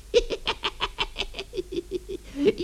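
breathless evil witch cackle, all air. Acted. Witch gloating over trapped Paulus, hissing cackle. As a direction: snicker wickedly under your breath.

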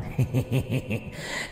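soft, rapid ghostly giggle; eerie mocking chuckle. A narrator's reading. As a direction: chuckle scornfully under the breath. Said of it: "Cái tiếng cười ghê rợn". (tiếng cười ma khinh miệt)

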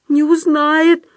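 high, meek character voice; timid echo. A narrator's reading. Худяков echoes Остап's reassurance, high timid voice.

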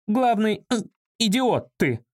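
high, outraged insult; irate woman pitched well above the narration; indignant woman. A narrator's reading. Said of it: "негодовала Валя".

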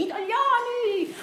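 high, slow, loud orator's cry; Mussolini imitation. Radio voice of the dictator addressing the nation, hammed-up oratorical cry. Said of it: "quella di Mussolini".

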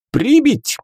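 high loud command; shouting. A narrator's reading. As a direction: bark out the order. Alyona orders to kill the book.

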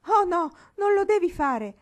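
high, loud cry of protest; alarmed cry. A narrator's reading. Marigold forbids her friend her own prayer, crying out. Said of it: "gridò Marigold".

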